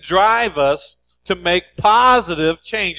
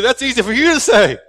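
pig protesting, higher and louder, building; outraged pig voice swelling to the punchline; indignant pig; 5 semitones higher, 6 dB louder than usual. Acted. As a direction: pig protests the bacon donation, indignant and loud. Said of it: "And the pig said".

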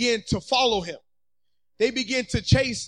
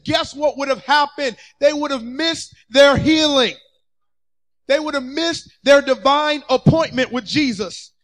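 ordinary talk

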